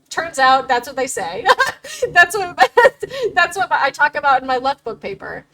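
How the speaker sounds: breaking into laughter mid-sentence; laughing. Real, not acted. Streamer to viewers about her paper, laughing. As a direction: chuckle through the words.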